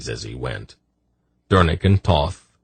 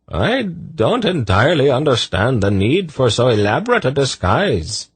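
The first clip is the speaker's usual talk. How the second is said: character voice; 8 semitones higher than usual. Acted. Zadi speaks.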